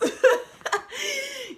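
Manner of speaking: high, loud genuine laugh; high burst of laughter. Real, not acted. Laughing about her brother's dry eyes, a high genuine laugh. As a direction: break into real laughter. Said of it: "This is a genuine laugh".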